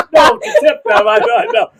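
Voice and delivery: high and loud, laughing through the words; laughing correction. Real, not acted. Setting her friend straight about the tip, laughing loudly. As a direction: correct her while still cracking up.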